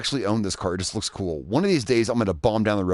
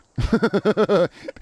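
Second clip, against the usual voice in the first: high giggle; 10 semitones higher, 3 dB louder than usual. Real, not acted. Laughing at his own dare.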